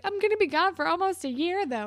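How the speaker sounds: high, loud objection; mock-whiny protest from a retold quarrel; upset protest.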